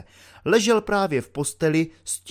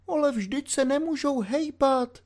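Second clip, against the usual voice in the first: put-on much higher fretful old woman's voice; worried old landlady; 12 semitones higher than usual. A narrator's reading. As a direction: protest anxiously as the landlady. (ustaraná stará paní)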